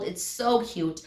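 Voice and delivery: ordinary talk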